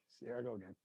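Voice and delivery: very quiet, low, nearly voiceless; choked up, dropping to almost nothing; real emotional moment. Real, not acted. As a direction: barely get it out, overcome. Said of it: "I'm emotional".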